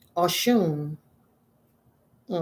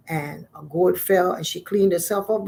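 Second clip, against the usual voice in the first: usual speaking voice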